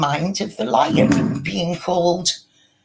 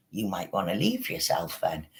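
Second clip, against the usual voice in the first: ordinary talk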